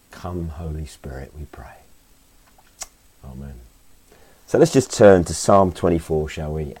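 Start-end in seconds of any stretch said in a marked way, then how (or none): 0.1-3.5 hushed whispered prayer. Real, not acted. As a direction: breathe it out in a whisper. Closing of the prayer.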